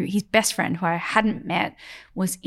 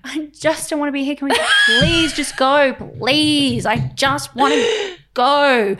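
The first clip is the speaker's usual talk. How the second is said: whiny, higher begging with stretched words; exasperated pleading; 5 semitones higher, 4 dB louder than usual. Retold or imitated. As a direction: plead impatiently to leave. Begging partner to leave exhibition.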